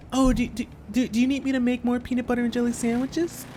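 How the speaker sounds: lightly voiced solicitous hostess; eager-to-help mom. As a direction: offer timidly as a fussing mom. Voices Andy's mom offering more sandwiches.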